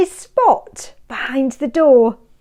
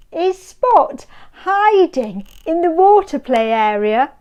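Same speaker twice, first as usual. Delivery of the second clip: plain reading voice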